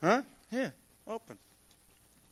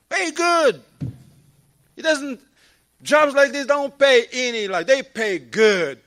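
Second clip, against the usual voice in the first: loud high retort; 6 semitones higher, 8 dB louder, slower than usual. Real, not acted.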